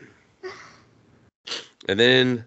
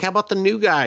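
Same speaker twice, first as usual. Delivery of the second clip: mock high voice; 10 semitones higher, faster than usual. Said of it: "they're just like".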